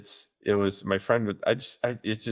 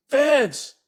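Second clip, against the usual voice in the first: mock call up the stairs, much higher and louder; 7 dB louder than usual. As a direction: summon the son like a strict dad. Dad calls son from upstairs.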